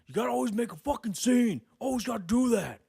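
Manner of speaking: loud, high mock yell of an annoyed cousin; mock angry cousin. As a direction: imitate the cousin scolding loudly. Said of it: "the guy always gets mad".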